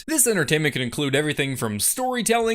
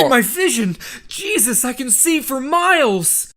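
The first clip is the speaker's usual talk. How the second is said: hammed-up wonder; 4 semitones higher than usual. Plant fakes miracle.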